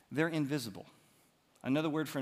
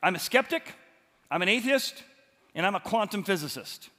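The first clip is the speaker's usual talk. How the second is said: loud, higher-pitched attempt at a German accent; mock German accent; 4 semitones higher, 8 dB louder than usual. Preacher playing a German seatmate introducing himself, loud mock German accent. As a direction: introduce yourself loudly in a badly faked German accent. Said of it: "so the German guy says".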